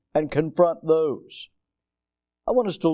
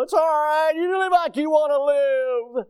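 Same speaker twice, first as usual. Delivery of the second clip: much higher, sing-song carefree voice; mock casual voice; 12 semitones higher, 4 dB louder than usual. Preacher mocking today's casual attitude to his congregation, high and lilting. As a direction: lilt it like a careless shrug.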